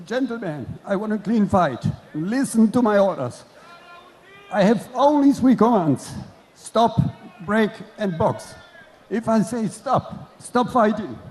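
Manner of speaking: loud, firm referee commands; referee instructions. Acted. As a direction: bark the rules at both boxers.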